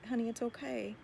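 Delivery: soft, hushed reassurance to a child woken from a bad dream; softly, as a mommy calming her little one; comforting mother. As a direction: soothe a frightened child back to sleep. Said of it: "you're gonna say".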